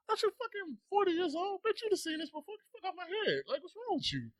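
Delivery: very high-pitched imagined rant; mock exasperated retort. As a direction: squeal out a sarcastic comeback in an exaggerated high voice.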